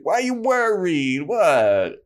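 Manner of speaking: high, exaggerated scoffing retort; mock incredulity. Angel dismissing Buffy's worry, mock-incredulous. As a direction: scoff back as the jerk boyfriend.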